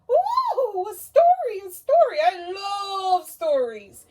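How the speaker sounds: very high, loud, gushing owl; excited puppet owl. Acted. Owl puppet thrilled about the story, squealing. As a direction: squeal with joy over story time.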